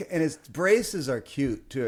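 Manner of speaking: ordinary delivery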